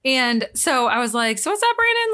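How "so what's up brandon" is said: high, breezy "what's up"; playful greeting. Retold or imitated. Woman greeting the gym man by the wrong name, playfully high. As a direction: pitch it up, casual and chummy.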